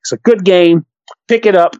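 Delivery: ordinary talk, nothing marked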